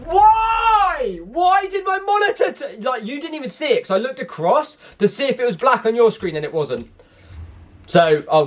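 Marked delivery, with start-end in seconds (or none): 0.1-2.8 real frustration, pitched way up; frustrated outburst. Real, not acted. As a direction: yell at the dead monitor.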